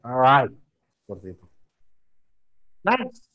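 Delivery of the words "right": "right" loud, with a falling push; "right" pressed downward. Shown as a demonstration. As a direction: lean down firmly on "right". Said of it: "Ada penekanan ke bawahnya dikit".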